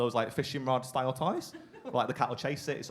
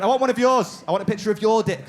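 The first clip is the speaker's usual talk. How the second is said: higher, louder insistent demand; demanding girlfriend; 7 semitones higher, 6 dB louder than usual. Retold or imitated. Girlfriend insisting on a real picture, loud and demanding. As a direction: push hard for what she wants. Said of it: "She went".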